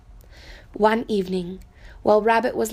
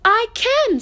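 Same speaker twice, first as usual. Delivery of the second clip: a high, eager little-rabbit voice; little rabbit insisting; 12 semitones higher, 5 dB louder than usual. Acted. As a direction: insist you can. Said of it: "said little Rabbit".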